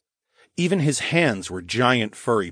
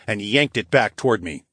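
plain reading voice

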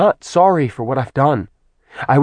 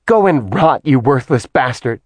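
louder, higher furious retort; angry goddess; 4 semitones higher, 4 dB louder than usual. Acted. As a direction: slap back with words. Artemis strikes back after slapping.

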